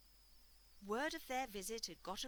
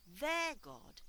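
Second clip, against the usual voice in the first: THEIR God, louder and higher; emphatic repetition; 5 semitones higher, 7 dB louder than usual. Acted. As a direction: repeat it with force, leaning hard on THEIR. Emphatic repetition while swearing to Ruth.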